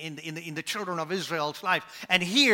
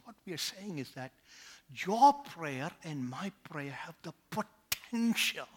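ordinary talk, nothing marked

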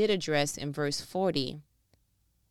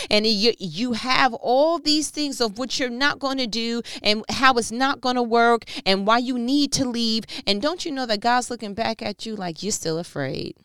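louder, higher preaching build; full-force preaching; 6 semitones higher, 6 dB louder than usual. Acted. Pastor urging the congregation to trust, preaching at full force. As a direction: preach it, climbing and pressing.

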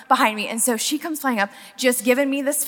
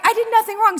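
pitched higher, insisting she did nothing wrong; higher insistent; 9 semitones higher, faster than usual. Retold or imitated. Driver insisting to the angry woman, higher. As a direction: lift the pitch, indignant but bright. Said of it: "But this time I was like".

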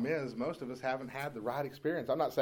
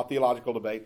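normal talking voice

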